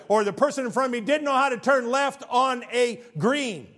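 high, loud exasperated rant; exasperated complaint. Retold or imitated. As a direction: vent about the bad driver. Mock whining about bad drivers.

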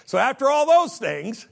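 laughing through the words. Real, not acted. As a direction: laugh as you say it.

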